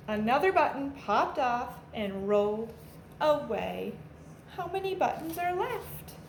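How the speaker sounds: put-on lively storybook read-aloud for kids; animated picture-book narration for a class; reading to children. A narrator's reading. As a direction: read it like a teacher at story time, bouncy and clear.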